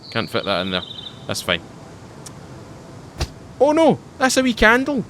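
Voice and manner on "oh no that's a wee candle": higher and louder sudden alarm; surprised. Real, not acted. Noticing a stray candle in his build, startled cry. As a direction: yelp at the unexpected find.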